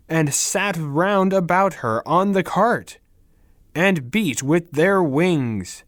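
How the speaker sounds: ordinary delivery, nothing marked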